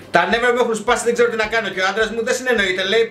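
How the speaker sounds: normal talking voice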